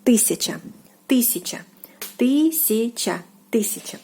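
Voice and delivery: тысяча said "TY-sya-cha", stress on the first syllable; Russian тысяча. Shown as a demonstration. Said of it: "it is тысяча".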